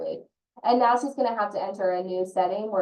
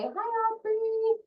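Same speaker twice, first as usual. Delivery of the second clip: put-on much higher, sweet small-child hello; little boy's greeting; 13 semitones higher, much slower than usual. A sweet little boy at the classroom door greets Aubrey, in a high child's voice. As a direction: chirp it like a tiny boy at the door.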